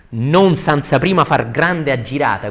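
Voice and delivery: loud, projected declaiming; verse recitation. Acted. Teacher rereading the verse to the class, loud declamation. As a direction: ring the line out to the room.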